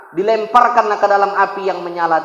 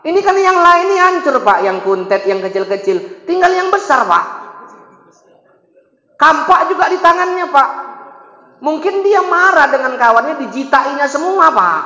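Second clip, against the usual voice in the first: a clever boy mocking the idols; cheeky explanation; 7 semitones higher than usual. Ibrahim explains the big idol did it, cheeky and high.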